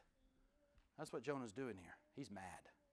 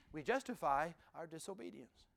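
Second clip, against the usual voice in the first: ordinary talk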